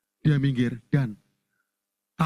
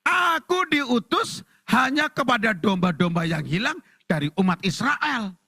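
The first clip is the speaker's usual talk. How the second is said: higher, slow declaimed verse; solemn proclamation; 6 semitones higher, slower than usual. Acted. Jesus answering the shouting woman, slow raised proclamation. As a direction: proclaim it with stretched words. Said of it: "Yesus ngomong gini".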